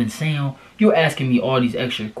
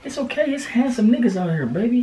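much higher, gentle reassuring tone; 11 semitones higher than usual. Real, not acted. Boyfriend reassures his upset girlfriend, in high gentle baby talk. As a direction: tell her it's fine, sweetly.

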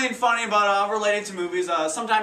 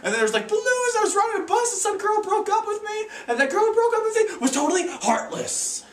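wide-eyed and dazed, pitched way up; bewildered excitement. Acted. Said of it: "I'm like".

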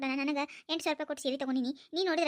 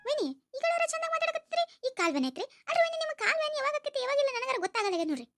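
sweet-talking sister-in-law; 9 semitones higher than usual. Acted. Sister-in-law needling the sister-in-law about her leg pain, high sugary taunt. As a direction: coo it sweetly with a sting underneath.

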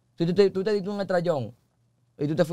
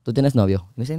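normal talking voice

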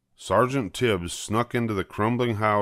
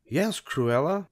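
higher, slow polite woman's voice; patient reply; gentle higher Anita; 8 semitones higher than usual. A narrator's reading. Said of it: "said Anita".

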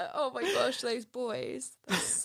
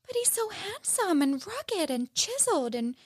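gushing mum; 9 semitones higher than usual. Retold or imitated. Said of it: "she's like".

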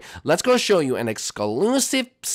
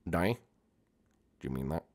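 much lower, much softer small voice; hurt whimper; 9 semitones lower, 11 dB quieter, faster than usual. Acted. Jeffy hears his statue will fall, hurt and quiet. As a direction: ask it barely above a breath.

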